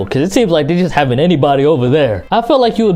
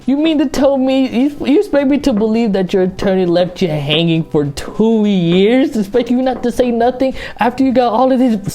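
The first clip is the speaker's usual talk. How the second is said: incredulous challenge; 6 semitones higher than usual. Real, not acted.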